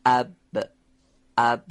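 "اب" with lips shut on a voiced b, then released; thin "اب" ending in a popped b; plosive ba demo. Shown as a demonstration. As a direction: say "اب" twice, isolated, stopping firmly on the b. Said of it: "به صورت انسدادی و نازک".

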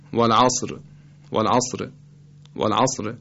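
والعصر ending in a very brief, faint kasra after ر; waqf with rawm. Shown as a demonstration. Teacher demonstrating stopping by rawm, والعصر with a whispered-short final kasra. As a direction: stop on والعصر leaving a third of an 'i' on the light r. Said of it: "صدای بسیار مختصر، ثلث حرکت کسری".

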